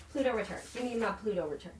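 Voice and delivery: very soft, half to oneself; talking to oneself. Real, not acted. Astrologer searching for her Pluto return, quietly to herself. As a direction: drop right down to an absent little murmur.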